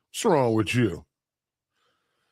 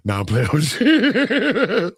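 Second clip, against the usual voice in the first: high, slow, teasing; giggly reassurance; playful laugh; 10 semitones higher, 4 dB louder, slower than usual. Real, not acted. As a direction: laugh off the threat. Backing off joking threat.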